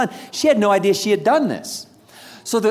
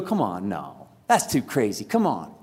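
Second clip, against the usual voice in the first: much lower, soft incredulous scoff; disbelief; 11 semitones lower, 6 dB quieter than usual. Retold or imitated. Listener reacting to an unbelievable story, low soft scoff. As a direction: scoff like it's too crazy to believe. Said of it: "that you go".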